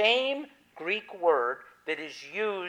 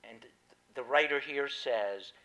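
ordinary talk

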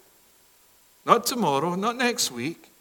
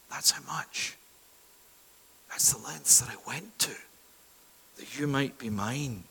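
soft, lower hushed voice; 5 semitones lower, 10 dB quieter than usual. Acted. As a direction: let it sink in softly. God speaks after the cry.

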